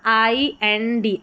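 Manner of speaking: IND spelled out very slowly letter by letter; slow spelled-out pattern; slow spelling. Shown as a demonstration. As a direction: drag out IND slowly for the class. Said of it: "जैसे IND दे रखा है ना".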